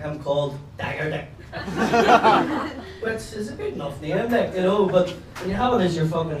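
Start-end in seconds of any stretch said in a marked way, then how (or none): 1.3-3.0 rising burst of laughter. Real, not acted. Laughing at his own Dagger Dick punchline. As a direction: laugh at the new name.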